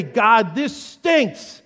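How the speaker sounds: loud plea for help; honest cry. Acted. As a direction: cry out plainly to God. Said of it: "Why not say".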